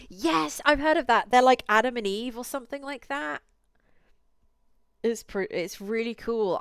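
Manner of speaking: loud, high, eager yes; excited. Real, not acted. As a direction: burst in with enthusiasm. Eager reply about vampire movie.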